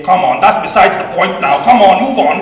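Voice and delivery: Uncle Mike arguing. Retold or imitated. Nephew imitating uncle waving off an argument, loud. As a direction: brush the point aside, loud and amused. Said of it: "having an argument with him".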